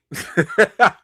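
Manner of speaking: loud, high burst of laughter; amused laugh. Real, not acted. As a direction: laugh out loud, genuinely tickled. Amused by actor's lookalike picture.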